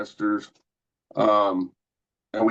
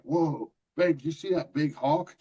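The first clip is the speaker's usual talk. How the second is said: higher-pitched, excited retelling of amazement; retold amazement; 7 semitones higher than usual. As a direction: call to your babe in lightly acted wonder, pitch lifted. Retelling his amazed question to girlfriend.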